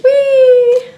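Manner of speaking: high, loud little squeal of joy; excited squeal. Real, not acted. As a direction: let out a delighted whee. Unboxing toy, happy squeal.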